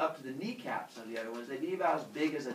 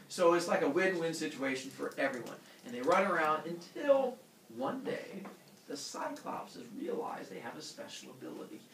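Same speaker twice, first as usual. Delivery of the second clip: ordinary delivery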